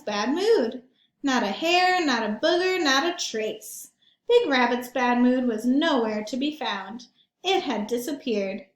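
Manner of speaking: put-on lively read-aloud for kids; storybook narration. A narrator's reading. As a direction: tell it to the children with relish. Reads picture book aloud.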